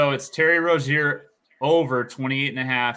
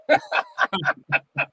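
laughing; 4 dB louder than usual. Real, not acted. Teased about the name.

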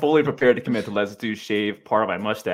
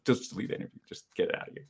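mock whispered plea; 8 dB quieter than usual. Begging his partner to delete footage.

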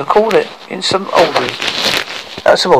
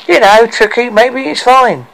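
high, louder dwarf voice, drawn out; amused; 10 semitones higher, 5 dB louder than usual. Acted. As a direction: suggest it with amusement. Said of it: "Bumpy laughed and said".

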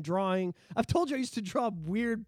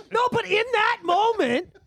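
high, slow, laughing emphasis; 14 semitones higher, 7 dB louder, slower than usual. Real, not acted. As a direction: laugh through the words slowly.

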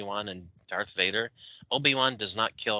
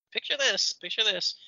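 high, set-up phrase "picture this"; dramatic storyteller; 11 semitones higher than usual. Shown as a demonstration. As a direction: draw them into the scene. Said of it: "dramatic setup".

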